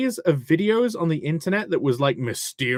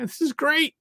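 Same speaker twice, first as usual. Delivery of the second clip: high squeaky voice; 12 semitones higher, 4 dB louder, faster than usual. Pretend.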